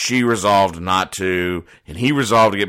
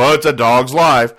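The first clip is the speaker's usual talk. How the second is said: louder, higher mock lament of "Oh, it's a dog's life"; hammed-up sorry-for-myself groan; mock weary sigh; 5 semitones higher, 7 dB louder than usual. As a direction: play someone moaning "it's a dog's life". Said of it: "I've never heard anybody go".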